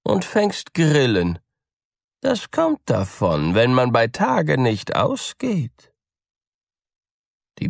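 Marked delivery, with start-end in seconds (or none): 0.0-5.9 high, teasing cat voice; mocking cat. A narrator's reading. Cat teasing the mouse, high and mocking. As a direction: sneer at the stay-at-home mouse. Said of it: "sprach die Katze".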